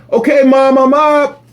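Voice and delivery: high called-out reply; calling out. Retold or imitated. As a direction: call upstairs to mom. Yelling back to mom.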